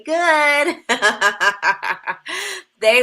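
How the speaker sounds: amused, laughing proudly. Real, not acted. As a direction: chuckle it out. Laughing about her classes.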